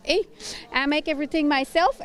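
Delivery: ordinary talk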